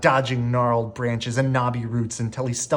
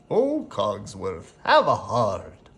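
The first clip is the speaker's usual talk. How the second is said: slow, put-on French candelabra pleading; 3 semitones lower, slower than usual. A narrator's reading. The candelabra begs the mantle clock, slow and pleading. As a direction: coax a fussy friend to soften.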